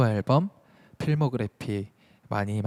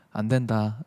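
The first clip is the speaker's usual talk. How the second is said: usual speaking voice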